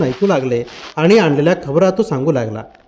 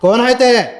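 calling out; 5 semitones higher than usual. Acted. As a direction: challenge a stranger who is approaching. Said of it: "सावळा पुढं होऊन ओरडला".

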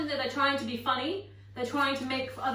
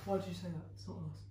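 mock classmates; 7 semitones lower, 8 dB quieter than usual. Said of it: "other people will be like".

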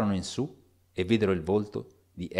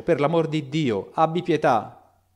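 slow, higher, louder plea in a changed voice; pleading strange voice; 5 semitones higher, 5 dB louder, slower than usual. A narrator's reading. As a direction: beg for mercy, drawn out. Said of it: "questa non è la voce di Jekyll". (supplica lenta con voce alterata)

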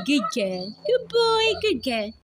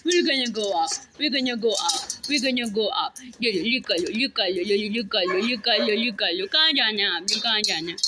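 soothing pet talk; 9 semitones lower, much faster than usual. Real, not acted. Soothing a dog.

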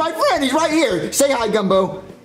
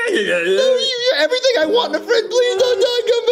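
higher, crying plea; tearful pleading; 10 semitones higher than usual. Acted. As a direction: beg through tears. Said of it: "stop crying".

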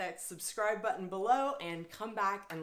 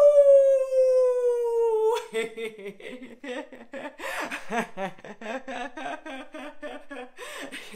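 loud rolling high laughter; laughter-yoga belly laugh; 8 semitones higher, 10 dB louder than usual. Shown as a demonstration. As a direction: laugh big after the howl. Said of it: "howl at the moon and laugh".

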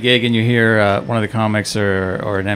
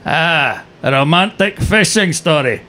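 higher, deadpan Scottish cabbie; thick Scottish accent; 4 semitones higher than usual. Retold or imitated. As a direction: dismiss it like a Scottish driver. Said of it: "thick Scottish accent cab driver".

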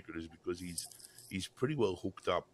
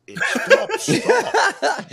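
high squeal of laughter, begging stop; cracking up and choking out stop; laughing plea to stop; 18 dB louder, much slower than usual. Real, not acted. Laughing at his own rant.

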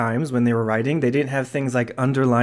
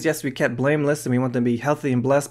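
usual speaking voice

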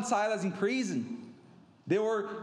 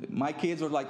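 ordinary talk, nothing marked

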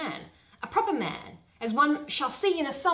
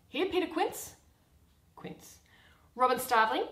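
ordinary delivery

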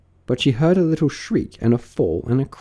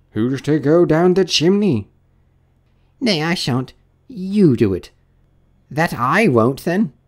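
higher-pitched bickering character voices; squabbling workmen; 7 semitones higher, 4 dB louder than usual. Acted. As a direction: argue over who gets the job. Workmen squabble over the chimney job.

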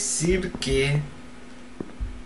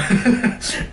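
nervous laugh; 7 semitones higher than usual. Real, not acted. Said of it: "Me estoy metiendo miedo".

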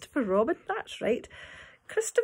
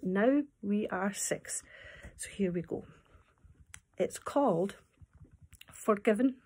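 plain reading voice

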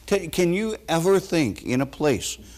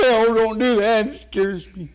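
mock scared; 10 semitones higher than usual. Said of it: "and people say".

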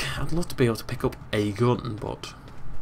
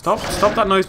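raised, higher shout; 8 semitones higher, 5 dB louder than usual. Real, not acted. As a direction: yell it out. Yelling at unseen raptor.

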